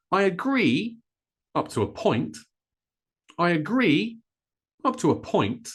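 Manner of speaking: "agree" high on its second syllable, "point" stressed after a quick "up to a". Shown as a demonstration. Teacher modelling two stresses to learners, high "agree", strong "point". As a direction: punch the "gree" of "agree", pause, then land on "point". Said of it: "we stress the second syllable of this word".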